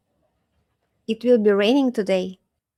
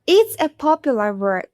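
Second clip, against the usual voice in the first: ordinary talk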